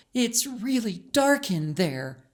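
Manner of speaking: put-on a little girl uneasy at the tunnel mouth; young girl voice. A narrator's reading.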